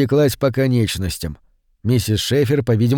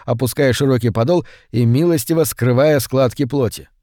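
ordinary delivery, nothing marked

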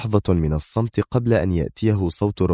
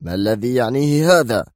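higher, edgy question; nervous edge; 4 semitones higher than usual. A narrator's reading. Lawyer questions the guard's orders, edgy. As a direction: snap out the question, rattled. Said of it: "قال مانتراك في عصبية".

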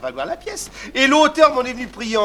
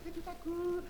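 ordinary talk, nothing marked

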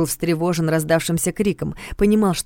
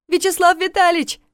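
high loud call from afar; 13 semitones higher, 4 dB louder than usual. Acted. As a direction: shout his name. Voice calls from the dark.

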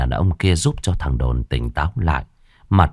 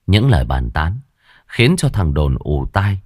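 plain reading voice

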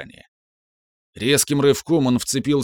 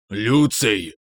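ominous bass rasp. Acted. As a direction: rasp the name out darkly. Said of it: "зловещим басом прохрипел Марк".

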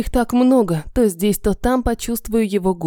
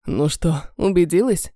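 мужской голос; 5 semitones lower than usual. Acted. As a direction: lower male voice, teasing. Эмин спрашивает, мужской голос. (низкий дразнящий мужской голос)

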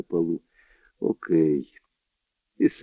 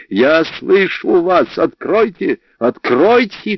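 loud, high-pitched demanding shout; 9 semitones higher, 6 dB louder, slower than usual. A narrator's reading. Coridan tries to break into the bedroom, shouting. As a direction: yell it through a locked door, building in pitch. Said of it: "крикнул он".

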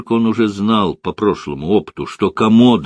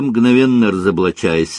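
ordinary delivery, nothing marked

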